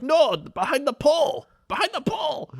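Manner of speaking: high, loud put-on yell; mock yelling. Retelling a parking lady yelling at a driver, high put-on shout. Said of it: "yelling at him".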